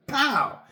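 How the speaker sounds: sharp high pow for a gunshot. Retold or imitated. Telling how the soldiers shoot, a mock gunshot. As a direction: spit out a gunshot noise.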